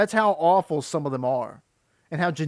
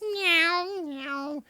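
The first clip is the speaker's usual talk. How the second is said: cat meow; 12 semitones higher than usual. Retold or imitated. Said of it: "it would just meow".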